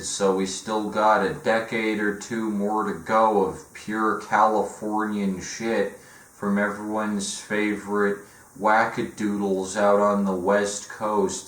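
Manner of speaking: ordinary talk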